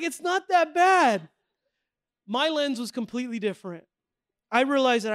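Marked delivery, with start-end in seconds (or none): 0.0-1.3 mock disbelief. Retold reply, friends shocked.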